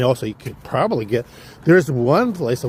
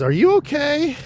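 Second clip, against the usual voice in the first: much higher, a concerned wife voice; voicing the wife; 3 dB louder than usual. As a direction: ask like a worried wife, high and light.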